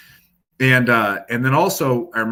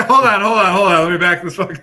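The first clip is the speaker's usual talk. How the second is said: laughing; 8 semitones higher, 10 dB louder than usual. Real, not acted. Laughing interruption.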